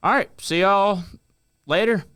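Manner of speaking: tossing a casual goodbye as he heads out; bus driver voice. Retold or imitated. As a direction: toss off a casual so long like the driver. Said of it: "the bus driver".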